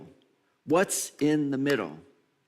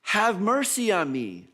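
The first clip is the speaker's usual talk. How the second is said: loud, high, drawn-out plea; yelling out; 6 semitones higher, 4 dB louder, slower than usual. Retold or imitated. Blind person calling to Jesus going by, yelling out. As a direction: call out for mercy, stretching the words slowly. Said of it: "And he yells out".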